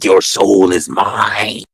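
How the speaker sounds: slow, loud, gloating soul-thief declaration; menacing villain line. Acted. Soul snatcher gloating over his victim, slow and loud. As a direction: savour the claim like a movie villain. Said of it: "I palmed her fucking forehead and I said".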